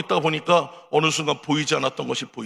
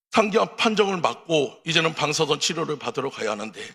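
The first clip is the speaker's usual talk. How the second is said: ordinary delivery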